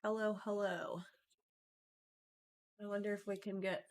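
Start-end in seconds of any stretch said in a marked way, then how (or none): none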